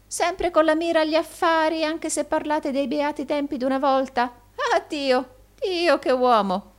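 high-pitched, gushing wonder; hammed-up admiration; fawning old usurer, marvelling. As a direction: exclaim loudly in a high old voice. Gride marvels at Rodolfo.

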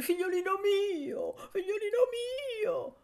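put-on high, sobbing cry of a loving old woman. Old servant greeting her boy at the station, weeping. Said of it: "cominciò a piangere".

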